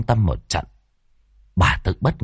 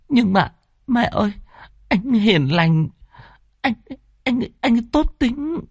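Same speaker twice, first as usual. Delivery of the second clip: high, halting explanation; young daughter pleading her lover's case through tears; tearful confession; 12 semitones higher than usual. Acted. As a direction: confess timidly in broken pieces. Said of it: "Tâm hai mắt đẫm lệ".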